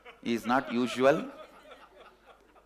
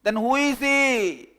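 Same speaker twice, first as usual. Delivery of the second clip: shouting; 9 semitones higher, 8 dB louder, slower than usual. Acted. Calling out confused.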